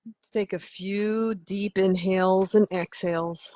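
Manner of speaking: measured, unhurried meditation voice; guided meditation. Acted. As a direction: lead them into relaxation with long pauses. Leads guided relaxation.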